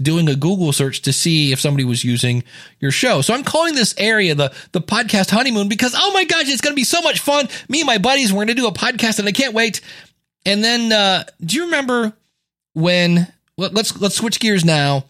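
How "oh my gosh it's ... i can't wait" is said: high, breathless gushing; giddy excitement. Retold or imitated. New podcaster telling buddies plans, giddy and high. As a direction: bubble over with excitement.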